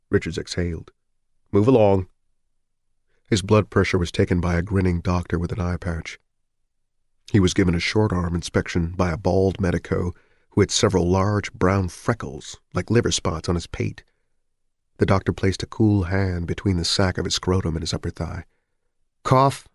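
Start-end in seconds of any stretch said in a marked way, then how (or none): 1.5-2.1 curt doctor. A narrator's reading. Doctor waves him on. 19.2-19.7 higher, clipped one-word order; curt doctor. A narrator's reading. Doctor's routine command.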